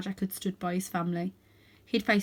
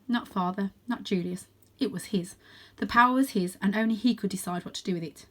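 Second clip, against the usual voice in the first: plain reading voice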